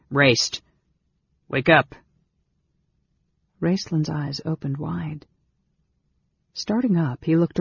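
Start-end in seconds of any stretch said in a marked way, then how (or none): 0.1-1.9 firm, raised voice to wake a sleeper; louder rousing call. A narrator's reading. Brother shakes sleeping mage awake, louder and urgent. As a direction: call out insistently.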